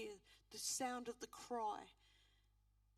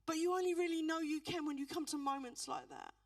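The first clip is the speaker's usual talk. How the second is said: loud, higher, impassioned preaching; preaching at full force; 4 semitones higher, 8 dB louder than usual. Acted. Minister urging the congregation to trust God, loud preaching. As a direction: build to a shouting altar call.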